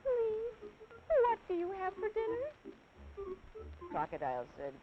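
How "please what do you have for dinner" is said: put-on very high, breathless, excited child; excited panting. Elephant's child finally meeting the crocodile, panting excitedly. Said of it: "so excited he panted".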